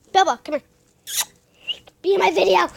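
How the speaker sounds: loud call to the dog. Real, not acted. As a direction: call her over, loud. Calling the dog Bella.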